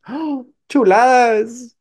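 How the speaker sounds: high, drawn-out cry of delight. Acted. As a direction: gush over the great news. Visitor thrilled by landlord's number. (sorpresa encantada, aguda y alargada)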